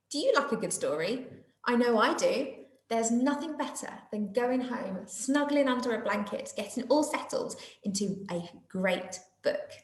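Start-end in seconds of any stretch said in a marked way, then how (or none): none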